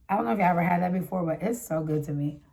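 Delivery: ordinary talk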